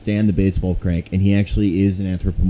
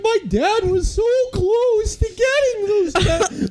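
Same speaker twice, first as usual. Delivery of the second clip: very high, slow squeaky cartoon voice; vintage cartoon voice; slower than usual. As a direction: whine it like an old cartoon character. Mocking Crank in same cartoon voice.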